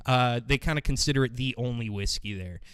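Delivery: usual speaking voice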